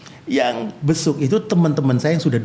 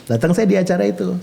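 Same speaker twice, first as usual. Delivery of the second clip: usual speaking voice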